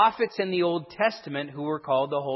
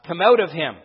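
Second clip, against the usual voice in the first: firm, louder order to leave; Jesus ordering the demon out: stern and loud; stern command; 7 dB louder than usual. Acted. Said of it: "said sternly".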